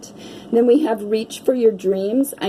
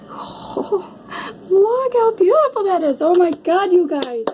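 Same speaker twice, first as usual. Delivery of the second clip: much higher, gushing squeal; squealing delight; 9 semitones higher than usual. Real, not acted. Reader showing viewers a card she adores, high delighted squeal. Gushing over tarot cards.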